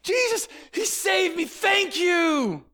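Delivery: healed leper shouting his thanks to Jesus; loud praise. Retold or imitated. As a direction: cry out thanks, overjoyed. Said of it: "he goes on, he's just like".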